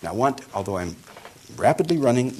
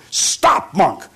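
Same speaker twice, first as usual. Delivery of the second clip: loud barked halt; shouted command; 6 semitones higher, 9 dB louder than usual. Acted. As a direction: yell at the monk to stop.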